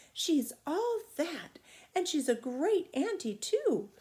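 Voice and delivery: put-on a small girl marvelling at her great aunt; little girl's voice. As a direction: sound like an excited small child. Child voiced in read story.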